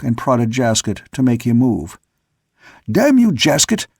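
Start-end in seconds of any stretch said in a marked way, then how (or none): 2.9-3.9 angry roar. A narrator's reading. Sailor roaring at a stuck old mate, furious. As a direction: roar at him in rage. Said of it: "I roared".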